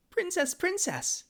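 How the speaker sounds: princess princess squeaked very high and slow; squeaky princess. Shown as a demonstration. For chat's request, princess princess in a very high squeal. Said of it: "Say princess twice".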